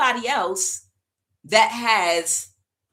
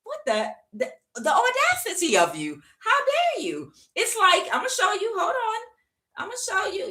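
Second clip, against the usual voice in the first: much higher, indignant squeal; hammed-up high-pitched fury; mock outrage; 13 semitones higher than usual. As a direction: squeal it in disbelief as the wronged husband. Husband outraged at cheating wife.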